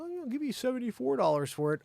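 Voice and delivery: high-pitched put-on buyer voice; mock haggler. Buyer lowballs seller on marketplace, high mock haggler.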